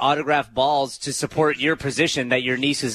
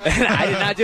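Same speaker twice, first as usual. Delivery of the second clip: laughing protest; 5 semitones higher than usual. Real, not acted. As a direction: shout it through a laugh.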